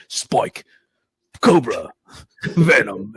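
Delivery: low, slow fighter roll call. Retold or imitated. Podcaster mocking one-name rosters, mock game-announcer voice. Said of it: "like Mortal Kombat".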